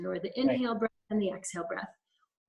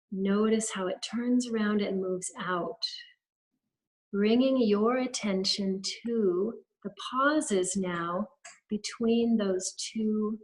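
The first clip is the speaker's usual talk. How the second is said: guided meditation. Acted. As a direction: lead them gently through each breath, unhurried. Leads a guided breathing practice.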